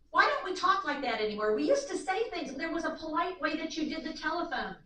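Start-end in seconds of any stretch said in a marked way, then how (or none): none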